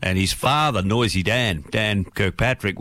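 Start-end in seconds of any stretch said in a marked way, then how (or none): none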